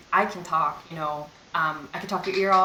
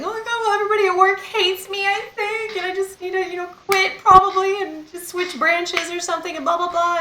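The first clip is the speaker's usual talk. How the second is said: much higher, louder, whiny near-tears voice; teary breakdown rant; 12 semitones higher, 5 dB louder than usual. Retold or imitated. Telling viewers about her breakdowns, a high teary wail. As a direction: play back your own crying meltdown, wailing.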